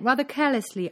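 ordinary delivery

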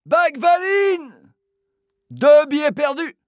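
high, loud, drawn-out call; shouted order. Acted. Boss yelling for his doorman. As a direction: Philippe calling Bagvaline across the hall, shouting.

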